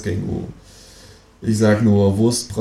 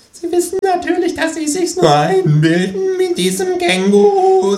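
shrill; 5 dB louder than usual. A narrator's reading. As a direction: squeal it out in alarm. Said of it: "sagt der Psychiater schrill".

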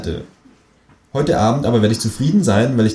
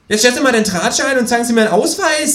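high, fast, loud demand; kangaroo voice, bossy; 5 dB louder, faster than usual. A narrator's reading. Känguru bosses the policeman, high and fast. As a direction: order him around in the high kangaroo voice.

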